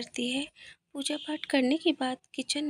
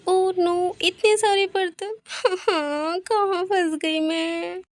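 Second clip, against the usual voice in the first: higher-pitched, drawn-out moan of dismay; acted groan of being overwhelmed; dismayed wail; 5 semitones higher, 5 dB louder, slower than usual.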